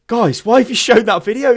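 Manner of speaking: mock outrage at the podcasters; retold dismayed protest. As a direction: protest in disbelief, retelling it. Retelling own protest to podcast.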